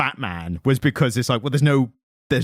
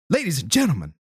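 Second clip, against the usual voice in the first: higher, halting announcer impression; 7 semitones higher than usual. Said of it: "best [name] snapshot of an impression".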